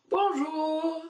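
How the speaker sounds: high, cheery bonjour; bright hello. Shown as a demonstration. Teacher showing pupils how to greet cheerfully. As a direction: sing out a friendly bonjour. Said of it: "on dit bonjour".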